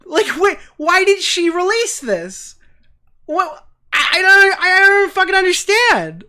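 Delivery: high and loud, half laughing in disbelief; you can't wrap your head around it; incredulous. Real, not acted. Streamer baffled why she released it, high and incredulous.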